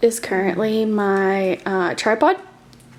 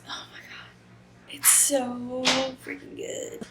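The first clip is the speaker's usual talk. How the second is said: whispered, softer, slower; excited whisper; 2 semitones higher, 9 dB quieter, slower than usual. Real, not acted. Vlogger whispering to viewers about a gripping book, excited whisper. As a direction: hush it like a guilty secret.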